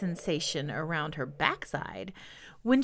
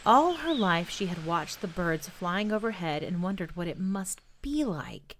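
ordinary delivery

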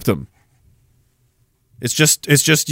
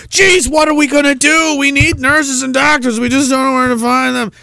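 mock panicked officials; 8 semitones higher than usual. Nova Scotia officials complaining about no doctors, hammed-up panic. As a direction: ham up helpless government worry.

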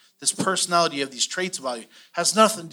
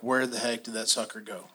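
muttered under the breath, much softer; quiet mutter; 7 semitones lower, 11 dB quieter than usual. Retold or imitated. Someone staring at an empty paycheck, muttering softly. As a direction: mumble it to yourself, puzzled.

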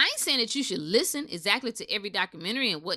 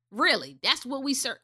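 ordinary delivery

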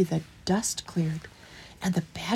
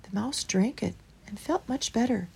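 ordinary delivery

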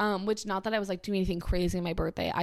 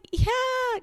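very high, put-on yes; squeaky forced agreement; fake enthusiasm. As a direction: feign excitement so mom isn't let down. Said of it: "didn't wanna, like, crush my mom's thunder".

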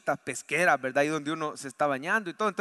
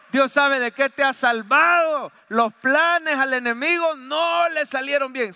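higher, louder and slow, proclaiming; full-force preaching; 5 semitones higher, 7 dB louder, slower than usual. Acted. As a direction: preach it out over the congregation.

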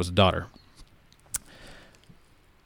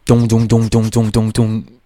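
rapid, evenly hammered "dong" gunshots; imitated gunfire; 4 dB louder than usual. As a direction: mimic a burst of heavy fire with your voice. Imitating sudden heavy gunfire.

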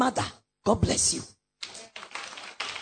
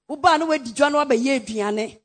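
normal talking voice

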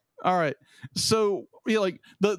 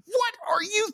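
exasperated. Retold or imitated. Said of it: "it's just like".

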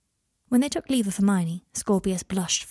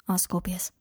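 ordinary delivery, nothing marked

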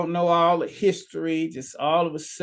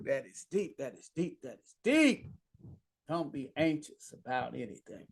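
awed hush; 2 semitones lower, 11 dB quieter than usual. Real, not acted. Preacher savours the verse.

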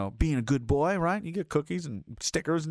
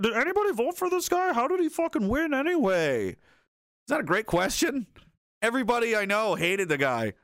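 ordinary talk

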